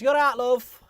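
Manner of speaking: high, loud Northern English woman; chirpy Northern English woman calling out; northern accent. Retold or imitated. Woman waking him in field, Northern accent. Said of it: "had to deal with that accent".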